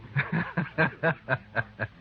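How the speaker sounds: a short amused chuckle; amused laugh. Acted. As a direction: laugh it off at the accusation. Amused at accusation.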